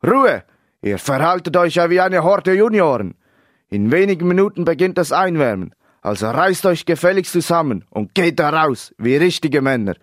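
loud, high, barked dressing-down; angry coach's tirade; blustering coach. A narrator's reading. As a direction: bellow the team into order. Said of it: "polterte der Trainer".

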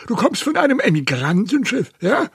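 high, loud, quick questioning; suspicious old uncle. A narrator's reading. Uncle Delbert questions Mary about the ship, high suspicious uncle.

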